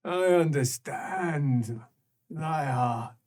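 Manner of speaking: slow, low and knowing; slow creature voice. Acted. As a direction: speak with slow ancient knowing. Creature speaks.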